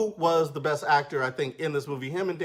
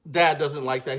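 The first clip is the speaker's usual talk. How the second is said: usual speaking voice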